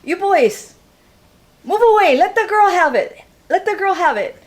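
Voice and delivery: big Hawaiian man shouting orders from the ship; loud booming voice. Retold or imitated. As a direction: boom it down from the deck at the boys. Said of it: "In a really loud voice".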